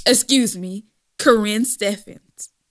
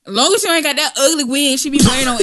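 ordinary talk, nothing marked

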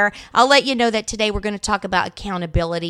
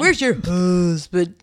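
slow and lower put-on voice; lightly mocking slow question; goofy slow voice; 5 semitones lower, slower than usual. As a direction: drawl the question playfully. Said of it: "[name] is on a funny one today".

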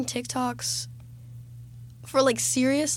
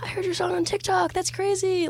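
excited fan; 8 semitones higher than usual. Retold or imitated. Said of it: "be like".

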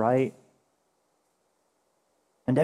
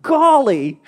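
high, loud Midwestern mom golly; 10 semitones higher, 7 dB louder than usual. Retold or imitated. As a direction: imitate mom's chirpy Midwestern golly. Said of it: "sounds like a Midwesterner".

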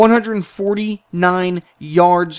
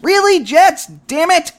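higher, louder frustrated outcry; exasperated fan; 11 semitones higher, 5 dB louder than usual. Real, not acted. Fan yelling at the Jets, exasperated outburst. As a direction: groan at the team's loss.

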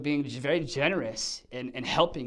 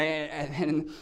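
ordinary talk, nothing marked

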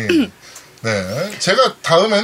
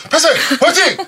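shouted high cheer; hyped cheer; 11 semitones higher, 5 dB louder than usual. Acted. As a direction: cheer it out loud. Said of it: "섹시한 목소리로 폐생 화이팅 부탁드립니다".

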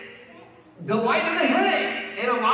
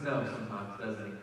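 soft divine reply; 13 semitones lower, 11 dB quieter than usual. Pretend.

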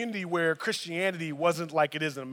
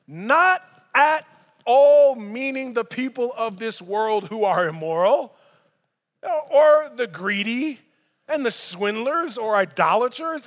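emphatic scripture reading; 5 semitones higher, 7 dB louder than usual. Retold or imitated. Reading Paul's words.

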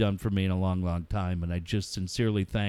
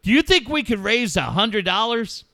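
higher, louder acted kid's question; excited kids blurting out; 10 semitones higher, 5 dB louder than usual. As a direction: blurt it out like a wide-eyed kid. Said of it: "they just blurted out".

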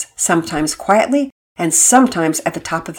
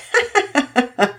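short amused chuckle, higher; light chuckle; 8 semitones higher than usual. Acted. Mother laughs fondly at her son's excitement. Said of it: "Mama Peters laughed".

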